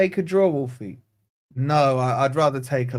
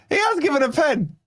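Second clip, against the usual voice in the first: much higher, stressing has; can't believe it's happening; thrilled disbelief; 10 semitones higher than usual. Real, not acted. Fan announcing the penalty to viewers, thrilled and high.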